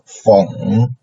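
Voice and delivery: FENG dipping low, then rising; falling-rising FENG; third tone. Shown as a demonstration. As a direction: drop FENG rather low and let it come back up. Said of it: "is rather low".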